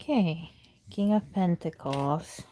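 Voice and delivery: ordinary talk, nothing marked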